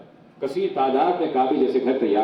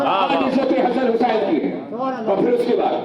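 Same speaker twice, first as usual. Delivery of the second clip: full-force preaching; 8 semitones higher than usual. Real, not acted. Preacher voices God's answer on honour to the congregation, rousing proclamation. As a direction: build the list for a responding crowd.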